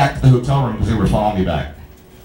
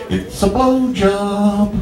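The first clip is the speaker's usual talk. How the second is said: slow punchline; 6 semitones higher, much slower than usual. Acted. Punchline to girl in magic trick.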